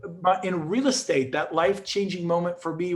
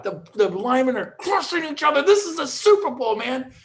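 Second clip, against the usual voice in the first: excited; 7 semitones higher, 3 dB louder than usual. Real, not acted. Hyping Super Bowl intensity.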